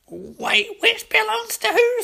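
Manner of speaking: very high, squeaky creature voice; confused greedy creature. Acted. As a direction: squeak the baffled question. Larfleeze asks in confusion.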